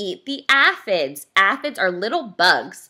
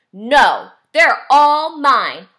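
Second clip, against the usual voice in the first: loud, possessive storybook yell; grouchy ladybug screaming, hammed up for children; 5 semitones higher, 10 dB louder, much slower than usual. A narrator's reading. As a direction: yell it slowly, building to a higher final claim. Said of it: "screamed the grouchy ladybug".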